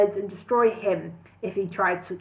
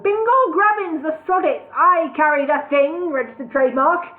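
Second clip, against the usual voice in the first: high, loud proclamation; announced; 7 semitones higher, 6 dB louder than usual. A narrator's reading. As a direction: step forward and declare it. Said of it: "he announced".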